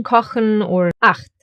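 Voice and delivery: "kochen or acht" with the ch scraped in the throat; German "kochen", "acht", each with harsh back ch; harsh throaty ch. Shown as a demonstration. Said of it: "sounds harsher and is formed more in the throat".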